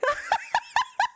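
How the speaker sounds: high squeal of laughter. Real, not acted. High laugh after asking the judge not to cancel her. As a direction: let a high laugh burst out.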